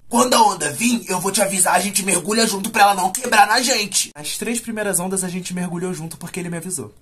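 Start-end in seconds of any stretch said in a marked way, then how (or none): 0.1-4.1 put-on smoker's voice; smoker uncle. Uncle telling nephew when to dive, smoker's voice. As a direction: imitate a smoking uncle giving instructions. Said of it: "Ele falava assim que ele era fumante".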